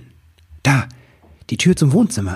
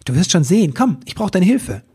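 high, excited little elf; eager elf voice; 7 semitones higher, 3 dB louder than usual. A narrator's reading. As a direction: urge her on like a busy elf. Elf Ribbelwitsch speaks.

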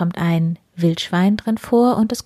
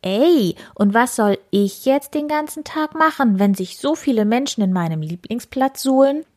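higher boar voice, huffing and cross; a grumpy pig complaining; annoyed boar; 6 semitones higher than usual. Acted. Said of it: "Wildi schnaubte verärgert". (verärgertes Wildschwein, höher, schnaubend)

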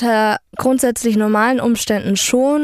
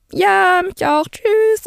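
much higher, slow, sing-song fake politeness; sarcastic goodbye; 9 semitones higher, much slower than usual. Saying goodbye to the late guy, high slow sarcastic tschüss. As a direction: drag out a sarcastic farewell. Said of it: "Und ich so".

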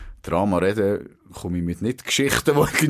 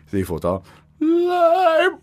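ordinary talk

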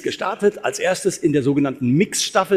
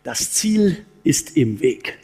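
slow punchline; 3 semitones lower, much slower than usual. Shown as a demonstration. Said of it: "einen viel besseren Satz für Sie".

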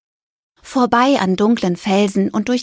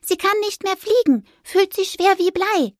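high squeaky mouse voice; little mouse; 11 semitones higher than usual. Acted. Mouse urging the ghost on, high and squeaky.

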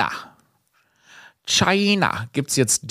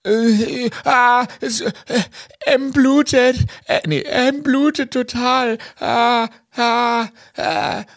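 high, stammering panic, much higher voice; panicked friend on phone; 11 semitones higher, slower than usual. Retold or imitated. Friend on the phone reporting his buddy is bleeding, high panicked stammer. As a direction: gasp it out like a friend in shock over blood. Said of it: "Mein Kumpel N am Telefon sagt".

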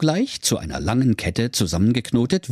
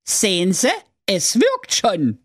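triumphant call; 11 semitones higher than usual. Acted. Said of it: "Und der Klaus zwei rief".

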